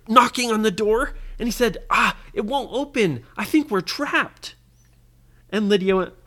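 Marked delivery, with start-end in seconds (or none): 1.9-4.6 put-on high, worried kid voice; hammed-up fear for a child audience; mock panic. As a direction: sound trapped and a little frantic. Ryan trapped, knocking on door.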